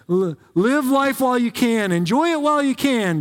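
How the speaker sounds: excited Solomon paraphrase. Retold or imitated. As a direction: sell it like a cheerful preacher. Voicing Solomon celebrating.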